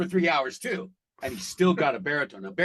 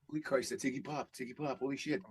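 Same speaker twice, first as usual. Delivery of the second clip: awed mutter; 2 semitones lower, 10 dB quieter than usual. Retold or imitated. Starstruck at seeing [name].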